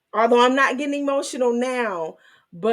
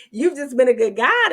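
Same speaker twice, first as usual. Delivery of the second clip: louder, raised praise; a touch of giddy gratitude; gushing praise; 4 semitones higher, 7 dB louder than usual. Real, not acted. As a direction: praise him with a burst of joy.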